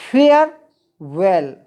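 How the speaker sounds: "फेयरवेल" slow, split as फेय, then वेल, no R; silent R demo. Shown as a demonstration. Teacher showing students a diphthong, "फेयरवेल" slowly split with silent R. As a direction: stretch "फेयरवेल" in two parts, dropping the R. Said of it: "फेयर में आर silent है".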